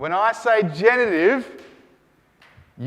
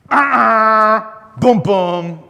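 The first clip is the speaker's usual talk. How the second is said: mock alarm; 3 semitones higher, 9 dB louder, much slower than usual. Teacher warns against a mistake.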